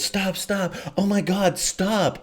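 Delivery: imitating her high, pleading stop; mock older woman. Mocking the older woman begging him to stop, high. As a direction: squeak it out like the woman in bed. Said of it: "puts her arms on my stomach and goes".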